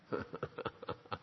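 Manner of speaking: breathy little chuckle under the breath; a quiet, knowing chuckle; soft laugh. Real, not acted. Preacher chuckling to the congregation at his own point, breathy. Chuckle after Bible remark.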